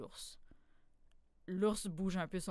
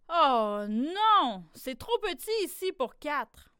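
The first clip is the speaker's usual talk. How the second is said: loud, higher protest; animals refusing; 4 semitones higher, 7 dB louder, slower than usual. A narrator's reading. Animals inside refusing the wolverine, loud high protest. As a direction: cry out no room.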